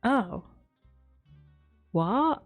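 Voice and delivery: usual speaking voice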